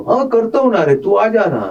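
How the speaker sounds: high-pitched, quick-to-agree imitation of a friend; Amol Gupta. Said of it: "I went to Amol Gupta and said".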